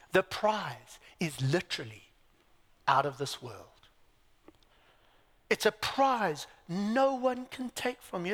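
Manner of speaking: ordinary talk, nothing marked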